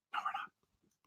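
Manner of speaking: breathy whisper, almost silent. Acted. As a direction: whisper it like a secret aside. Teasing whisper, then repeated aloud.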